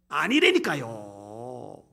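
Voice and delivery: low, very slow, drawn-out "아니라니까요"; emphatic denial. Real, not acted. Preacher denying Moses lacked faith, slow insistent denial. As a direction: stretch the denial out low and long.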